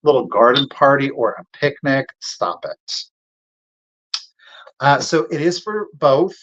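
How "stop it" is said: breathless whispered stop it; whispered swoon. Real, not acted. Seller swooning to viewers over the picnic set, whispered. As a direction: whisper it like it's too cute to bear.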